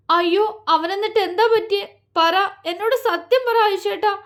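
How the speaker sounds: high, urgent, begging for the truth; anxious pleading. A narrator's reading.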